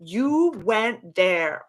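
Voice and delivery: high, drawn-out, moved reaction; real emotional gush, slow and high; getting emotional. Real, not acted. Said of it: "getting emotional just thinking about it".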